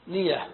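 آنية broken as "أَأْ - نية", second hamza a glottal stop; two separate hamzas. Shown as a demonstration. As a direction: say أنية with a hard catch before نية. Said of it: "يعني بهمزتين". (أنية بهمزتين، الثانية ساكنة)

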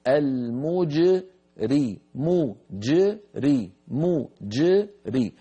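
"مجري" ببطء شديد وجيم مقلقلة قبل الكسرة; أنهِ الجيم تمامًا ثم الكسرة; قلقلة الجيم. Shown as a demonstration. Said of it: "كذلك كلمة المجرمون". ("المجري" بجيم ساكنة مقلقلة واضحة)

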